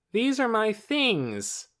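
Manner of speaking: put-on slow, high boastful boy; loud. A narrator's reading. Torin to everyone about his bag, loud and slow. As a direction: announce it to the room, slowly. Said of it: "he said loudly".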